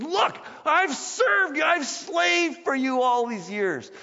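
high, slow, loud resentful complaint; indignant shout. Acted. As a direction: throw years of grievance at the father. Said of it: "He's demanding the father's attention".

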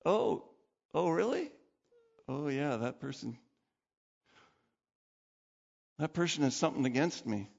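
halting, let-down murmur of remembering; dawning realisation. Acted. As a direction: let the praise deflate as it sinks in. Worshipper's deflated realisation.

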